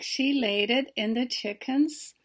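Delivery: ordinary delivery, nothing marked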